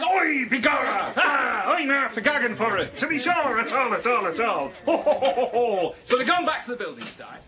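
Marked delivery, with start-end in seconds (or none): 0.0-4.7 stage Irish leprechaun voice; hammed-up Irish joke accent, slow start. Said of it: "He says". 4.9-5.9 hearty laugh. Real, not acted. Laughing at own joke.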